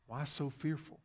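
very soft, hushed asking; gentle question. Acted. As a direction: quietly question frightened followers. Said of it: "he looked at them".

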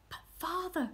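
ordinary delivery

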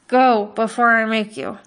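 loud, menacing warning; threatening. A narrator's reading. Butcher threatening the hunter with a knife, loud menace. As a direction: threaten him to leave now.

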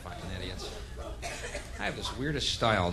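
ordinary talk

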